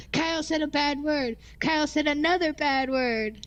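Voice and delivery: high, teasing tattle; teasing tattletale. Pretend. Co-host telling on Kyle for a bad word, teasing.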